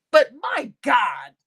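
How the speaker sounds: slow loud my God; drawn out exasperated cry; exasperated shout. Real, not acted. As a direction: bellow it in disbelief. Exasperated outburst.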